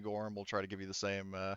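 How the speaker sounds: usual speaking voice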